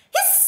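sharp, loud hiss; goose hiss. A narrator's reading. Goose hears of the plot, loud hissing. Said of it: "said the goose".

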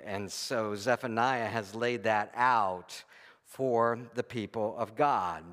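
ordinary delivery

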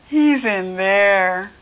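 slow, drawn-out glum reply; storyteller's mild moan of a mumble. A narrator's reading. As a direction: drag the words out woefully. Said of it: "mumbled Sam".

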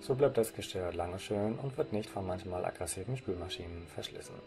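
usual speaking voice

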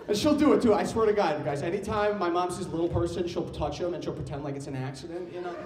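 normal talking voice

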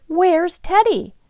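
slow, high, drawn-out question; wondering. A narrator's reading. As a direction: wonder aloud, stretching it out high. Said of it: "the queen wondered".

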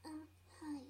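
soft timid pony voice; shy Fluttershy voice. Acted. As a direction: say it shyly and softly. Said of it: "Fluttershy twenty sixteen".

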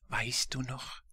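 softly, half-whispered ghostly question; zacht giechelende geesten. A narrator's reading. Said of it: "die Geister kicherten leise und sangen".

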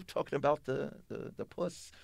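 ordinary delivery

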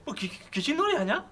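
loud, high startled yelp into scolding. Acted. Startled by someone sneaking up, yelling loudly with a laugh. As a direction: jump out of your skin then scold.

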